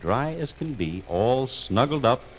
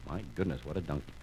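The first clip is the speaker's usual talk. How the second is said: low, soft comment to oneself; hushed aside; 8 semitones lower, 12 dB quieter, faster than usual. A narrator's reading. Narrator remarks on old donkey, low soft aside. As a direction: drop right down for an aside.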